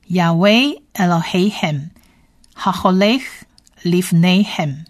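slow Hebrew reading of "Yahweh Elohim". Shown as a demonstration. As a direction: sound out "Haholech Lifnehem" slowly. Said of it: "我们再来念一遍". (慢慢再念一遍希伯来文)

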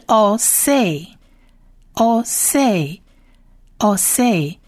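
"o say" sounded out very slowly, each syllable apart; teacher sounding out "o say" for listeners, very slowly; slow syllable drill. Shown as a demonstration. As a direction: spell out "o say" slowly for learners. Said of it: "所以就是".

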